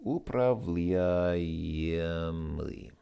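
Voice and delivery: upravljaemli said very slowly, syllable by syllable; slow careful pronunciation. Shown as a demonstration. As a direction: spell out upravljaemli with your voice. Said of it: "describable with the adjective".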